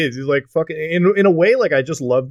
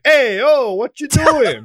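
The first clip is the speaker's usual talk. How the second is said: put-on Italian accent; 10 semitones higher, much slower than usual. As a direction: squawk it like a fake surprised New Yorker. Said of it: "Joey's like".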